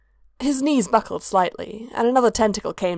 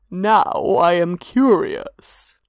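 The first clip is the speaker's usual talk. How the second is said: slow otherworldly god voice; 4 dB louder, much slower than usual. A narrator's reading.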